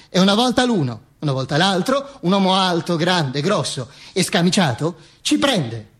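usual speaking voice

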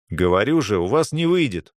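usual speaking voice

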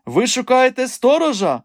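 high, loud, abrupt question; eager high-pitched interruption; sudden question. A narrator's reading. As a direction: blurt the question out, pitch leaping. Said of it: "раптом запитав Голий".

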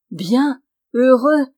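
loud, high burst of joy; overjoyed cry. A narrator's reading. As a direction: exclaim each word, beside yourself. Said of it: "cria Felipe hors de lui".

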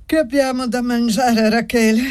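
high, stretched words through a yawn. A narrator's reading. Don Simone asking his daughter about supper, yawning. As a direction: ask it while yawning. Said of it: "domandò sbadigliando don Simone".

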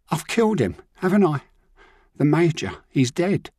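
put-on terrified boy confessing on the phone; panicked boy. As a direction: blurt out the fear. Ryan panicking about the Major.

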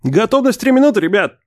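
high, carrying instructor's call. A narrator's reading. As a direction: call it out to the jumpers. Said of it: "предупреждает молодой парень в футболке инструктора". (инструктор громко объявляет)

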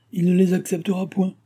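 high-pitched, emphatic contradiction; firm objection. A narrator's reading. Maid contradicting her mistress about the money, firm objection. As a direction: voice jumps up to insist.

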